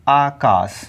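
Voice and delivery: आकाश said low and drawn out, long first आ; correct word said slowly. Shown as a demonstration. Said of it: "जो शुद्ध होगा वो क्या हो जाएगा".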